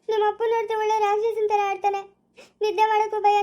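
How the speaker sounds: high, wailing whine; distressed, high-pitched lament; tearful complaint. Acted. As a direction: whine fearfully as if crying. Crying bride voices fear of father-in-law.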